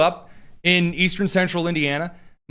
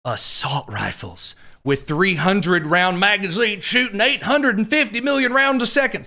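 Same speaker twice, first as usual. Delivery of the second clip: hammed-up alarmist claim, slow then higher; mocking exaggeration; 4 semitones higher than usual. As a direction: parody an overblown gun scare. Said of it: "that's how some idiots on the left describe them".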